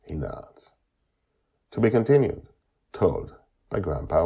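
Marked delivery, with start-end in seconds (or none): none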